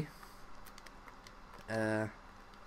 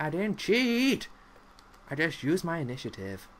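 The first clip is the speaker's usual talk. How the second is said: indignant protest; 6 semitones higher, 4 dB louder than usual. Retold or imitated. Accused by friends of cheating, an indignant loud protest. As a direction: protest your innocence loudly. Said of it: "I'm like".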